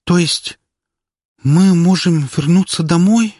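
slow, high, hesitant question; timid. Acted. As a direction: ask shyly, barely daring to hope. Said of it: "несмело спросила".

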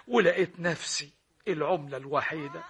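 ordinary talk

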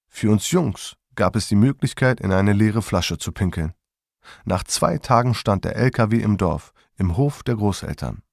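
ordinary delivery

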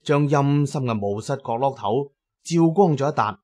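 usual speaking voice

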